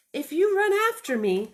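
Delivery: high, loud little bunny. Acted. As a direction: play the runaway bunny, bright and eager. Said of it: "said the little bunny".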